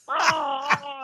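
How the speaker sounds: a high mock cry of pain, like a tooth being yanked; patient reliving the dentist pulling his teeth, high pained cry; retold scream of pain. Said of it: "I'm yelling and screaming".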